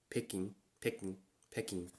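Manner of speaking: Peking said Japanese style, softly; Japanese pronunciation. Shown as a demonstration. Teacher showing the Japanese name, softly. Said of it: "the way you pronounce it in Japanese".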